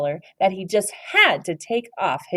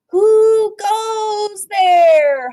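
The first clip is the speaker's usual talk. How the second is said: high, slow, loud holler into space; 8 dB louder, much slower than usual. A narrator's reading. Little astronaut hollers at the passing comet. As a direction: call out loud and long. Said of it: "hollered Scipio".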